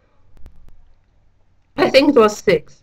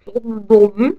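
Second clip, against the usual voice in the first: barely intelligible through marshmallows; cheeks stuffed full; 3 dB louder than usual. Real, not acted. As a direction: struggle to say it, mouth crammed. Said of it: "stuff marshmallows into your mouth".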